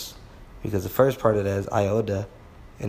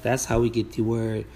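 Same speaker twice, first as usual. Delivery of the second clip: ordinary talk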